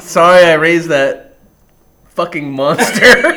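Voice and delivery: loud, slow mock-mortified apology; hammed-up exasperated parent. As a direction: draw it out into a laugh. Jokes an embarrassed father's apology.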